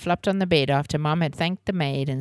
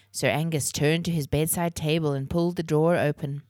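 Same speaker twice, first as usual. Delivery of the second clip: ordinary delivery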